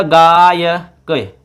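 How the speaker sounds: गायक said slowly, stretched on गा; sandhi word demonstration. Shown as a demonstration. Teacher shows how the word forms, drawn-out pronunciation. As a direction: draw out the long first vowel of गायक. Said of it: "तो बन जाएगा".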